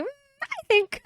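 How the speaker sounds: tiny high, drawn-out hesitant voice; mock hesitant guesser. Host teasing Caleb's guess, hammed-up hesitant squeak. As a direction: squeak it out slowly, unsure.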